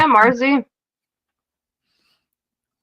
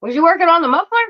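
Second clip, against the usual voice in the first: teasing uncle; 6 semitones higher than usual. Retold or imitated. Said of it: "He's like".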